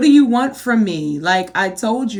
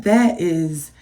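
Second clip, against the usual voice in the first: ordinary delivery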